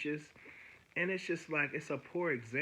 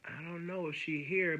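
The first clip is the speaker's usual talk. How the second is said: higher-pitched mimic of a receptionist; imitated desk lady; 6 semitones higher than usual. Desk lady telling him about the wait, imitated receptionist. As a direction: put on the desk lady's voice, higher.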